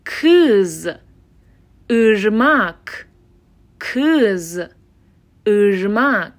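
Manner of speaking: "کز" and "ارماک" slowly with throaty ı; dotless I examples. Shown as a demonstration. Teacher giving examples, "کز" and "ارماک" slow with back unrounded ı. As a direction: stretch "کز" as kız, lips spread. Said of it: "از انتهای حنجره".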